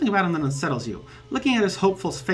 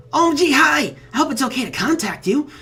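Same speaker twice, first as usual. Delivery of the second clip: high, eager, giggly fan voice; bubbly troll fan; 6 semitones higher than usual. Acted. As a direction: gush like an overexcited new friend. Troll's chat message read aloud.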